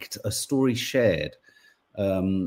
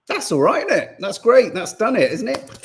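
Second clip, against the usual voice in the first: high, mock-satisfied sarcasm; sarcastic reassurance to the viewers; 9 semitones higher, 3 dB louder than usual. Real, not acted. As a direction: pretend it's all solved. Sarcasm to viewers about statement.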